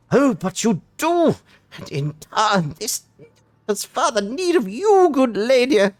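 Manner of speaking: a foppish knight begging with exaggerated courtesy; Punchably Posh. Knight pleads with the worm-haters, punchably posh. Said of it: "Punchably Posh".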